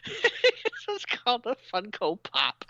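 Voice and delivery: high, drawn-out, laughing delivery; through laughter. Real, not acted. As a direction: barely hold in the giggles, stretching it out. Cracking up at own move name.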